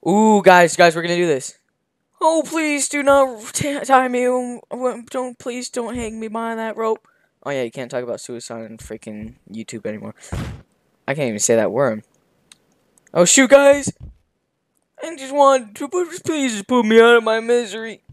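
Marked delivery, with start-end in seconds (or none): 2.2-7.1 put-on pleading old man. Old man pleading not to hang. 13.1-18.0 put-on begging old man.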